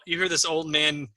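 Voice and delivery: ordinary talk, nothing marked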